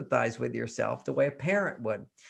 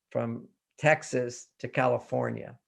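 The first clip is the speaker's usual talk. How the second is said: ordinary talk, nothing marked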